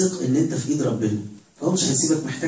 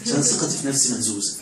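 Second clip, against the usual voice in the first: ordinary delivery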